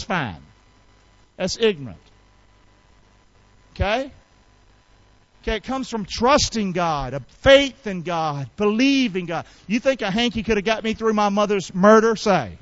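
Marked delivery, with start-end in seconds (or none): none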